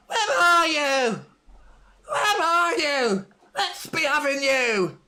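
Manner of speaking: high, slow, wailing half-laughed cry; drunken Delia Smith. Retold or imitated. Mocking Delia Smith's drunken pitch rallying cry, slow wailing shout. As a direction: bellow it like a tipsy chairwoman on the pitch. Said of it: "Delia Smith comes on the pitch like".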